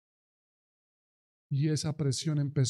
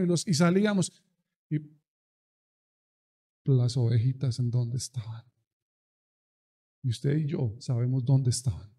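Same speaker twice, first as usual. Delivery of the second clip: ordinary talk, nothing marked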